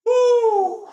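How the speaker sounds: high, sustained "woo"; loud whoop. Real, not acted. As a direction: let out a long high whoop. Exertion during burpees and push-ups.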